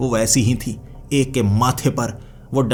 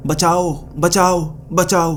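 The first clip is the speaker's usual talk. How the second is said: cry for help; 7 semitones higher, slower than usual. Acted. Unseen voice inside a closed factory, crying for help. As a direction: call for rescue in a raised pitch. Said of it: "उसके अंदर से आवाजें आ रही".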